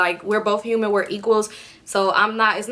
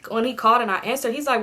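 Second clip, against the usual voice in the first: normal talking voice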